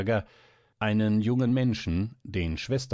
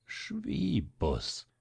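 drawn out, higher and softer; slower and calmer; 9 semitones higher, 4 dB quieter than usual. A narrator's reading. Stranger repeats the name after jumping up, slower and calmer. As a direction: stretch the name out quietly, high then falling. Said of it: "langsamer und ruhiger hinzu".

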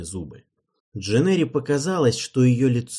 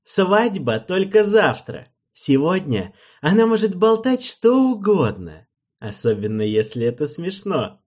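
amused king; 8 semitones higher, 6 dB louder than usual. Acted. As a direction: laugh it off as a joke.

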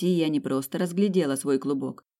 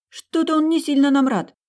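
high, loud nervous exclamation; alarmed; 11 semitones higher, 7 dB louder than usual. Acted. Heroine watches the fiery bird bearing down, alarmed cry. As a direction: voice jumps up in alarm.

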